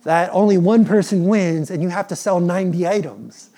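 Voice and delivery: hammed-up excited boy; son's excited wish. Son dreaming of the top prize, excited kid voice. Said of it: "And he's like".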